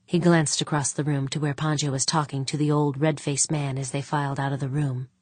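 usual speaking voice

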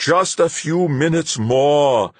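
high, drawn-out urging plea; coaxing, almost pleading. A narrator's reading. Fuchs urging the pilot on, coaxing, almost pleading. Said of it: "suddenly coaxing, almost pleading".

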